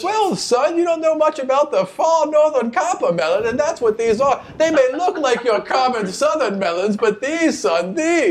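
put-on high, patronizing old woman; condescending old lady. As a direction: talk down to the boy. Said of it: "her nineteen bluff check says".

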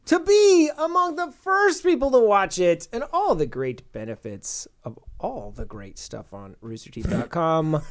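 slow, high announcer read; promo voice. Acted. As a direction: sell it like an ad read. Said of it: "You have a great promo voice".

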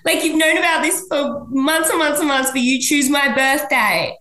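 high, mock-incredulous "really?"; pitch-jumping indignant mock quote. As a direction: squeak it out in disbelief. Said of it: "She's like".

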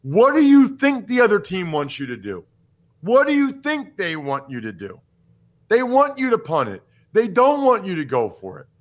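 lightly voiced gambling pundit challenging coaches; imitating Steve Fezzik. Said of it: "As Steve Fezzik would say".

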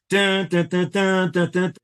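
high sung drum beats of a marching band. Retold or imitated. Imitating the marching band in the dream, chanted beats. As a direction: chant the band's drum hits.

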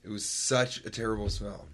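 usual speaking voice

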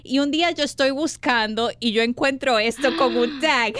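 usual speaking voice

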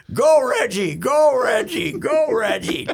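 loud, high mock fan yell; retold screaming cheer. Imitating Sid hurdling the press box barriers, screaming.

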